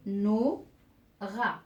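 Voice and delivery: Nora broken as "No... ra", long gap between; split into syllables. Shown as a demonstration. Teacher separating words into syllables for learners, split into syllables. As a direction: say Nora one syllable at a time. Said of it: "je vais séparer ces mots en syllabes".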